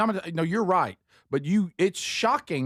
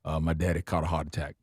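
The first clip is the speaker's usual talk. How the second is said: put-on Cajun accent; 7 semitones lower, faster than usual. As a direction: imitate a south Louisiana Cajun. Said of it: "this is as Cajun a phrase".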